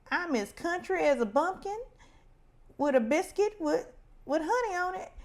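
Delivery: mock Southern country accent. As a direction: ham up the Southern country accent, pitched high. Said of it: "Kinda like when Jasmine would be in her, her D R".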